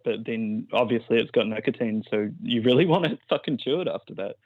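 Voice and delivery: ordinary talk, nothing marked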